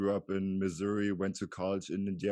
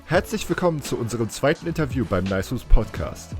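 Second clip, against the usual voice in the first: louder, lively German host welcome; podcast intro; 4 semitones higher, 10 dB louder than usual. Acted. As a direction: read the show intro with projected, lifted energy. Host's scripted podcast intro in German.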